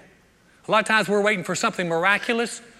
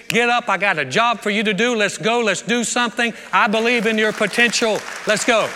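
louder, rousing charge to get moving; rally them to their feet, louder; rousing call to action; 4 semitones higher, 5 dB louder than usual. Retold or imitated. Jesus urging the discouraged believer to get up, loud and rousing. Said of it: "his comment to you is".

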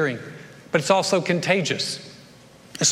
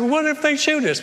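much higher, anxious squeak; mock worried; 8 semitones higher than usual. Leper fretting about being shot, in a high mock-worried voice. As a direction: blurt out the fear.

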